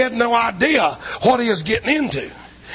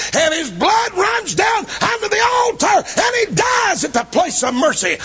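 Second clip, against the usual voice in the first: full-force preaching; 13 semitones higher than usual. Acted. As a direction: preach it at the top of your voice to the congregation. Sermon climax on Joab.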